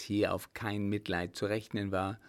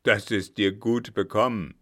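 loud, hard dismissal; loud, unfeeling brother; pitiless; 10 dB louder than usual. A narrator's reading. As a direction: throw it back without mercy. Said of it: "sagte er mitleidslos".

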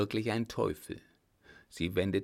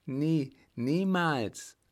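high, slow, drawn-out protest; defiant woman, voice pitched up; defiant refusal; 7 semitones higher, 3 dB louder, much slower than usual. A narrator's reading. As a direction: refuse him, stretching each word.

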